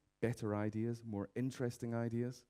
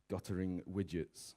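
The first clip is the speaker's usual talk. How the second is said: "guttering widgets" spelt out slowly and softer, as if typed; slow typing voice; 6 semitones lower, 6 dB quieter, slower than usual. Retold or imitated. Searcher typing the words into Google, slow and soft. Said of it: "you type in".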